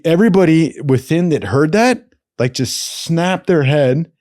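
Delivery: ordinary delivery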